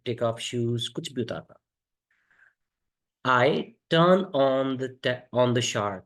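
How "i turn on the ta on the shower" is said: very slow, clear word by word; slow teaching model. Shown as a demonstration. Teacher models an English phrase for Hindi learners, very slow. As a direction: spell it out slowly for learners. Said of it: "कि मैं वो जो washroom में".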